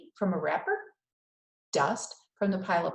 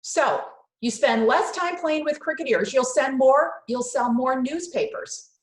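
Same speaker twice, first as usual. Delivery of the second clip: loud, higher scolding mother; scolding Mama; 6 semitones higher, 8 dB louder than usual. Acted. Mama scolding Mario over the newsstand, loud and high. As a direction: nag him about wasting time.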